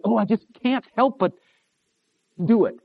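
a mock weak-willed believer whining; whiny sinner excuse. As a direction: plead like someone who gives in.

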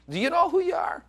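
rising challenge to the crowd; pointed high challenge. Real, not acted. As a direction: ask it straight at them, pitched high. Preacher's challenge to congregation.

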